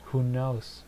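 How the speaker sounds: ordinary talk, nothing marked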